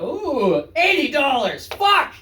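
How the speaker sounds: rising from pleased ooh to high shocked curse; mock price shock. Diner reading a pricey menu, building to a high shocked yelp. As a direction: climb into a yelped curse at the price.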